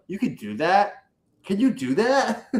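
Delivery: half-mocking wonder; amazed disbelief.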